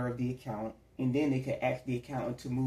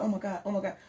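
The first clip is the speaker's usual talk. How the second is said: higher, breathless-sounding mock panic; frantic; 6 semitones higher, faster than usual. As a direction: act out a frantic accountant losing it. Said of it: "they frantic".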